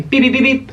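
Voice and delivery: high mock phone beeps; high beeping phone imitation; notification beeps. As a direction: chirp it like a phone alert.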